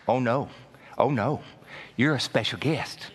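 low, soft Jesus welcoming a guest; gentle voice of Jesus. Acted. Jesus gently calls a guest to the front seat, soft and low. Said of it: "and Jesus says".

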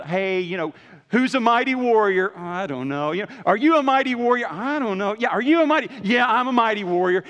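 high, loud hyped-up cheerleading; hammed-up hype-man back and forth; mock pep talk. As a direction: pump him up like a coach shouting. Mock pep-talk dialogue acted.

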